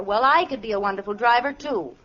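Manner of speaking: normal talking voice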